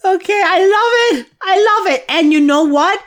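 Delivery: high, loud, delighted gushing; real delight. Real, not acted. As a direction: burst out thrilled at the joke. Delighted reaction to comedy clip.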